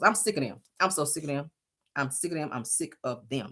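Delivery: fading mutter. Real, not acted. Repeats, fading away.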